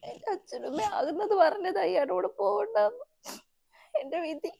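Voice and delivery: loud tearful wail, voice breaking; crying. Acted. Wife weeping after the fight, crying loudly. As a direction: sob out the regret.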